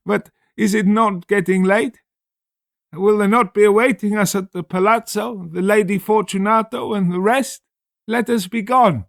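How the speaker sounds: high, loud, strained pleading voice; Fortunato's sad voice. Acted. Fortunato begging Montresor to go home, strained plea. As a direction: plead to leave, still pretending. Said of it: "a sad voice".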